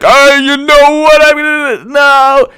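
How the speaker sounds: high, drawn-out mock yell; hammed-up high-pitched outrage; Robert De Niro. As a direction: slowly wail it up high. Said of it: "Robert De Niro's like".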